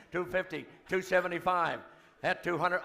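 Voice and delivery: rapid auctioneer chant. Real, not acted. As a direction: rattle off the bids like an auctioneer.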